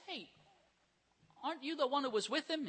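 accusing. Acted. Accusers voiced at the fire.

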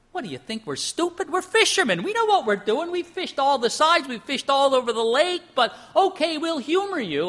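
high, mocking indignant Peter; scoffing fisherman. Peter scoffing at Jesus' fishing advice, high and mocking. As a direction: scoff at the carpenter's fishing tip.

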